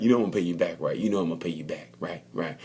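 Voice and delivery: soft, menacing murmur of payback; quiet mock threat. Rossi to Marquez, quietly warning of payback. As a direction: say it low and quiet like a veiled threat.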